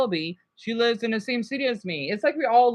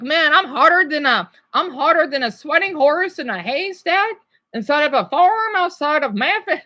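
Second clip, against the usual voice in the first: imitating Sunny Cheeks; 5 semitones higher, 3 dB louder than usual. Streamer mimics Sunny Cheeks for chat, in a light Southern accent. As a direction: mimic a Southern cartoon character's folksy boast. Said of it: "You know how she talks?".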